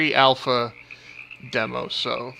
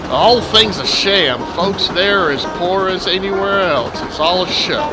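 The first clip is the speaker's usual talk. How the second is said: put-on southern accent. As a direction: lay the Southern accent on thick. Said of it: "exaggerate my already southern accent".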